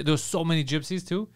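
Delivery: ordinary talk, nothing marked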